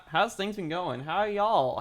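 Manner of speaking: usual speaking voice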